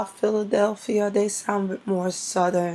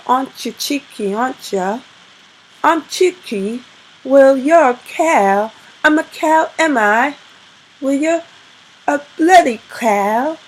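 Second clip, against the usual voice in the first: put-on upset English accent; 6 semitones higher, 8 dB louder than usual. Showing how British people talk when upset, heated English accent. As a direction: put on an English accent, building into a loud, high-pitched row. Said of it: "I could put on an English accent".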